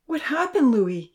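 high, urgent plea; begging. A narrator's reading. Professor begging Louis for news, urgent. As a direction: beg for the news. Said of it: "he begged".

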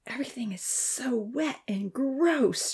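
slow, soft whispered complaint; Dia whispering as she steps through mud. A narrator's reading. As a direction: whisper it, disgusted.

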